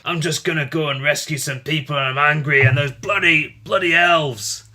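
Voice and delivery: mildly gruff, grumbling about elves; lightly rough dwarf. Shown as a demonstration. Showing his dwarf's voice on air, a hint of gruff anger. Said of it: "how do you give a geeky dwarf a voice".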